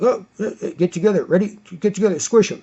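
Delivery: lightly voiced burly workers egging each other on; mock workers' huddle. Mocking construction workers closing in.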